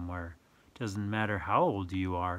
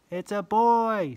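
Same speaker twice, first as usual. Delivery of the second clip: bright, high and louder exclamation; excited; 10 semitones higher, 9 dB louder, slower than usual. Real, not acted. Showing a child a picture, excited. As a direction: announce the news with delight.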